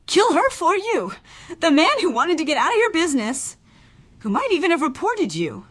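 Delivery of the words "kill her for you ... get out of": bitter accusation. Acted. Accusing Alf in confrontation.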